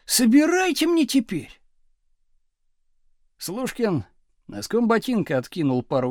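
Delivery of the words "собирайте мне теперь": high, indignant demand; outraged yell. A narrator's reading. Pupil demanding the teacher pick up his things, outraged. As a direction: yell the order with pitch raised. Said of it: "завопил Градусов".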